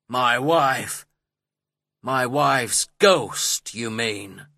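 slow, loud, stung retort; narrated affronted husband, slow and loud; indignant husband. A narrator's reading. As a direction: throw it back indignantly, drawing it out. Heathcliff indignant at mention of wife.

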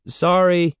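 called out. A narrator's reading. Evan calling to Andy after a bad toss, light call. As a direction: call it across the yard. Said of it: "Evan called".

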